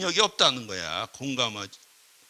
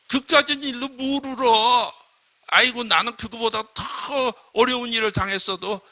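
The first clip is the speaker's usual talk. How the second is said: browbeating scold; 5 semitones higher, 3 dB louder than usual. Acted. Scolding someone for crying over nothing, browbeating. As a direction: talk down the person's tears. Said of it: "윽박질르는 경우들이 있다는 거야".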